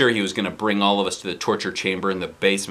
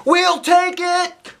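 loud high slow shout; yelling; 6 dB louder, much slower than usual. Acted. Kids accept the deal, yelling loudly. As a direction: shout it together. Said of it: "we all yelled".

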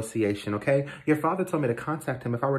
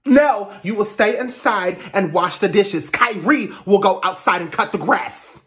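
raised, high-pitched insistence; angry command; 6 semitones higher, 5 dB louder than usual. Acted. Mother refusing her son's offer to help, angry command. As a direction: lay down the law, loudly. Stepmother overrules son in chore quarrel.

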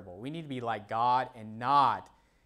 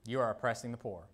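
normal talking voice

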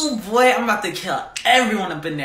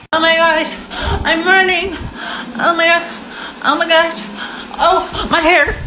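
panicked running; 9 semitones higher than usual. Acted. Running from a flood in a skit, hammed-up high panic. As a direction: gasp it out in bursts as if fleeing.

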